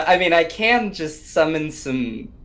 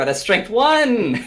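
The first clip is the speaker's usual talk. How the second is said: excited; 8 semitones higher, 6 dB louder than usual. Real, not acted. Good news in game stats.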